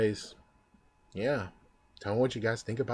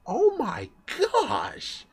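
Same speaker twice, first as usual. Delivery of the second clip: oh my gosh drawn out slowly, much higher; stunned delight; much slower than usual. Real, not acted. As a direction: gasp it out slow in giddy disbelief. Reacting to the dub voice.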